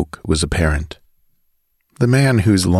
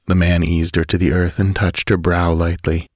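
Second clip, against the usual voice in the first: ordinary delivery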